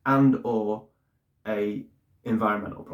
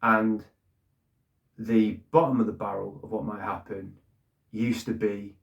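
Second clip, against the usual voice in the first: normal talking voice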